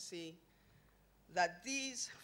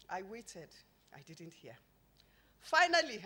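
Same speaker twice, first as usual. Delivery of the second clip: normal talking voice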